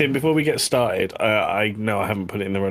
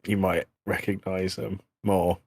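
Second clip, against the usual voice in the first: ordinary talk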